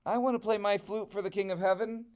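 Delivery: high and fast, eager little tortoise; pleading excitement, quick and high. Acted. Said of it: "he said".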